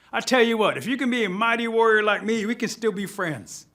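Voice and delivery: high, loud, bright-idea offer; eager turtle. Acted. Turtle offering to stay friends with Thunder, eager.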